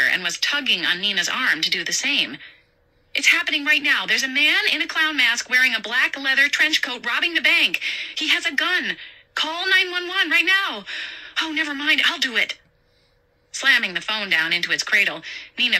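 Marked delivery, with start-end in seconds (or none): 3.1-12.6 put-on high, urgent, frightened girl; a young clerk phoning in a panic; panicked young woman. Nina voiced in panic.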